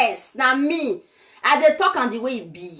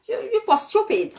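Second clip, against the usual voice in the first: ordinary talk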